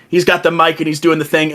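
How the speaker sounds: ordinary delivery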